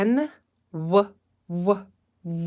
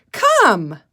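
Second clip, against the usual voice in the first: louder, insistent call; firm call to a pet; 3 semitones higher, 7 dB louder than usual. Shown as a demonstration. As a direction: make the cat listen. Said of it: "like you're calling your cat".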